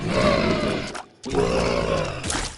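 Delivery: low, loud, slow boom; deep booming voice. Pretend. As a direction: boom it out like a giant. Booming joke demand.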